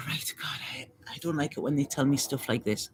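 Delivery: breathy whispered grumble to herself; hushed unvoiced aside. Real, not acted. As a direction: whisper it as a private complaint. Aside while reading cards.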